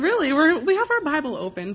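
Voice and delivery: high-pitched, half-laughing "Really?"; incredulous retort. Retold or imitated. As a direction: retell your own disbelieving comeback, pitch jumping up. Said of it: "And I said".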